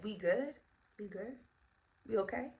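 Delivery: very soft, tentative questions; guilty check-in. Retold or imitated. Guilty friend checking on her, softly and tentatively. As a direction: ask it small and hushed, each question rising. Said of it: "I knew that she was guilty".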